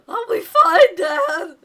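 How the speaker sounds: put-on high, loud child's voice crying out; young son, loud and high; eager kid. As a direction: play the excited little boy assuring his dad.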